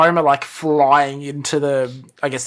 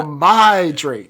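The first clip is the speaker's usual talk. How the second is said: louder, higher, drawn-out imitation; echoed mock demand; 4 semitones higher, 8 dB louder, much slower than usual. Co-host quoting Homer's demand back, drawn-out mock yell. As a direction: stretch it out slowly and loudly.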